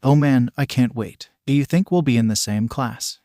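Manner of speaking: ordinary delivery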